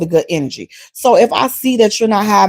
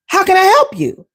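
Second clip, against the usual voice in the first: imitating barista; 9 semitones higher than usual. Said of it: "That's what the order is".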